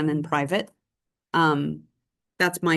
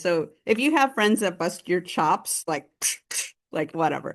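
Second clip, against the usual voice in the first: ordinary delivery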